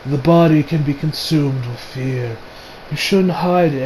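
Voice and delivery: low, hushed, slow villain impression; imitated villain voice. Villain addressing his frightened captive, low imitated voice. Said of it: "I love his English voice".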